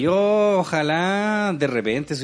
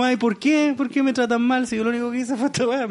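high, put-on whine; mimicking people who play the victim online; mocking whiny victim; 7 semitones higher than usual. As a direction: sneer at the self-pitying crowd. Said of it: "estos weones así que dicen como".

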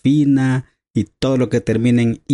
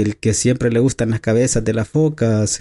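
normal talking voice

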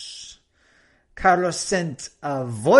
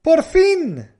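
relieved por fin; 12 semitones higher, 7 dB louder than usual. Shown as a demonstration. Example of relief, sun out.

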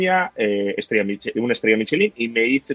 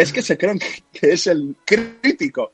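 put-on a light Cuban waiter accent, higher; imitating a Cuban accent; 4 semitones higher than usual. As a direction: mimic a Cuban waiter, half reluctant. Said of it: "al final lo voy a imitar".

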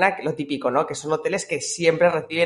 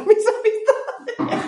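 riendo; much slower than usual. Real, not acted. Laughs at own joke.

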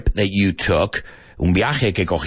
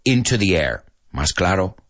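ordinary talk

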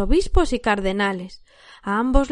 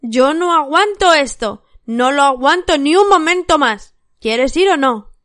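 loud, higher angry yelling; wife shrieking at her husband in fury; furious shout; 4 semitones higher, 6 dB louder than usual. A narrator's reading. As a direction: scream at him in rage. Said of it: "se puso a gritar".

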